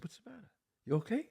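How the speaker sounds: soft, gentle, hushed question; quietly checking on the crying wardrobe woman. Retold or imitated. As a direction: ask softly so no one else hears. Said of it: "I said quietly".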